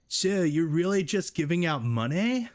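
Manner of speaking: different voice for Doug. Acted. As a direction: give Doug a goofy new voice. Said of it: "He has to have a different voice".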